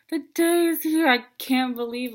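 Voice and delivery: thrilled, loud and high; excited disbelief. Retold or imitated. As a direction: burst out with it after a laugh. Reliving the big day, laughing.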